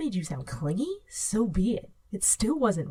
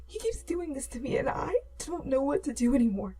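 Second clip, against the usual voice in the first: high, crying, voice cracking; tearful rambling; 7 semitones higher than usual. A narrator's reading. Girl crying to her friend on the phone, tearful. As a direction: pour it out through tears. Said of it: "you rambled".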